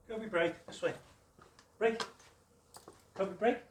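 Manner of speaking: loud high release command. Real, not acted. Trainer releasing dog.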